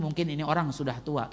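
usual speaking voice

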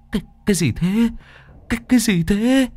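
high-pitched, stammering alarm; startled fright. A narrator's reading. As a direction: stammer in shock at a ghostly sight. Startled by face in the water. (hoảng hốt lắp bắp, giọng cao vút)

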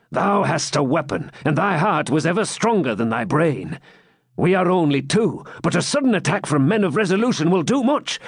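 rally your friend to action; resolute swineherd. A narrator's reading. Swineherd rallies jester.

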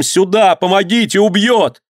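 high, urgent screams; cry for help. A narrator's reading. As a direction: call desperately for help. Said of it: "с криком". (крик о помощи)

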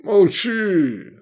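high, drawn-out pained plea; suffering voice. A narrator's reading. Queasy Gefestai hushing his friends, suffering groan. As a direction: beg for silence in misery. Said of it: "страдающим голосом сказал Гефестай".

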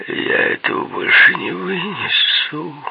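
high, drawn-out plaintive wail; slow, high whimper of someone at the end of their tether. A narrator's reading. Frightened man complaining to his companions, plaintive. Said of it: "сказал он жалобно".

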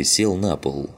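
ordinary delivery, nothing marked

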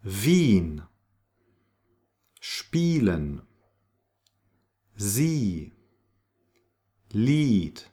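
Wien, Spielen, Sie, Lied with held ee; spaced model words, each IE as ee; long ee. Shown as a demonstration. Teacher models German IE, long ee sound. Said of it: "it's a long E".

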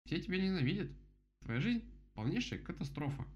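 high, emphatic rabbit voice; blunt verdict. Acted. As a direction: drive the harsh verdict home, pitched high. Rabbit bluntly contradicts the beaver. (резкий приговор высоким голосом)

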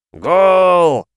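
very loud, high cheer; yelling. Acted. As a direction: bellow it from the stands. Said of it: "неожиданно заорали мужики".

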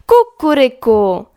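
a cross cock-a-doodle crow, hammed up; angry rooster crow. A narrator's reading. Rooster on a stick crowing angrily at the sparrow's noise. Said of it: "kogut na patyku zapiał gniewnie".

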